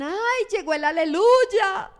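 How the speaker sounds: mock the churchgoer at work; lightly acted mocking jab; mocking coworker. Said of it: "le dicen".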